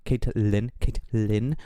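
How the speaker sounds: ordinary talk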